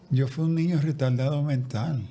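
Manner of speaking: ordinary talk, nothing marked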